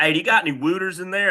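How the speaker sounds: put-on fast, high Baltimore accent. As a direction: rattle it off in thick Baltimore. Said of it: "that's a crazy accent".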